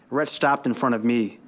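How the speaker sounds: ordinary delivery, nothing marked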